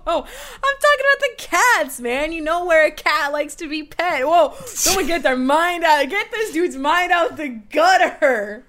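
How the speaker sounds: high, loud, laughing protest; mock outrage. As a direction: act scandalized and laugh.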